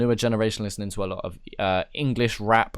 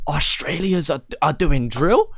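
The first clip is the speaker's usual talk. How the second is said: quoting a meme page, higher and louder with disbelief; surprised question; 4 semitones higher, 4 dB louder, slower than usual. Retold or imitated. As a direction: ask it like you can't believe it. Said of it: "posting, like".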